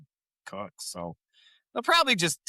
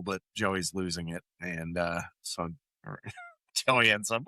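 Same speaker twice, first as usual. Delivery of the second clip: ordinary talk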